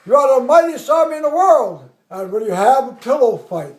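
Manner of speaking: loud oratory falling to a scornful finish; colonel's mock rebuke. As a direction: imitate a commander shaming his army. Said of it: "the colonel's there".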